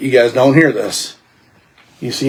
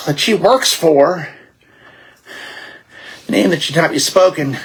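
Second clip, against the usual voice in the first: higher-pitched, troubled informant voice; grim informant; 6 semitones higher than usual. Acted. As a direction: recount ruin as a game-world informant.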